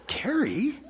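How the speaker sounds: mock astonishment.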